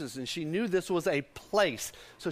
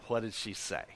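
low, soft question to the crowd; 8 semitones lower, 9 dB quieter than usual. Real, not acted. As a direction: ask it quietly, pitched down. Preacher's first, low asking.

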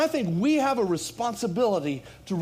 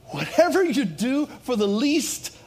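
loud, raised-pitch preaching with drawn-out key phrases; impassioned preaching; 5 semitones higher, 6 dB louder, slower than usual. Real, not acted. Pastor thanking his flock for doing it for Jesus, impassioned preaching. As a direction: preach it out to rally the church.

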